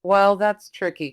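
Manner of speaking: ordinary talk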